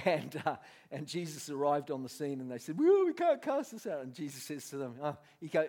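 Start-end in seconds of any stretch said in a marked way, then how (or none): 2.8-4.0 high, loud mock whine; whining disciples. Disciples complain to Jesus on his arrival, mock whining. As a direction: wail it helplessly, hammed up.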